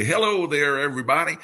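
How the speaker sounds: a light imitation of a cheery old ballgame announcer; Mel Allen's friendly sign-on. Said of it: "he would say".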